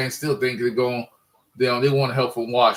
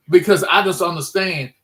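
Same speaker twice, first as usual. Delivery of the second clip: heated rant; 6 semitones higher than usual. Real, not acted. Fan ranting about predictable play calls, heated higher complaint. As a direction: vent the frustration with the play calling.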